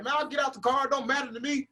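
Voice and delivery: high-pitched show of not caring; acting hard. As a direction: put on an unbothered bravado, no shouting. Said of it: "so I'm trying to act hard".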